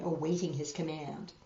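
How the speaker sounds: ordinary delivery, nothing marked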